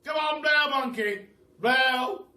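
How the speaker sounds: high, loud rousing yell; shout. Acted. The Duke urging the monkey to breathe fire, loud high shout. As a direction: holler it out like a showman. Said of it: "and shouted".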